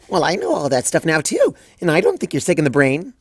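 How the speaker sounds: light, bouncy upbeat reassurance; chirpy Pinkie. Acted. Pinkie cheering up Twilight, chirpy and bright. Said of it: "chirped Pinkie".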